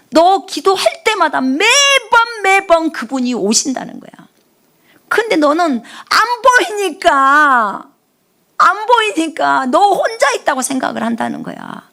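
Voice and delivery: high, very slow, emphatic; earnest relayed message. Retold or imitated. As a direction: stretch it out to press the point. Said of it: "닦는다는 거예요".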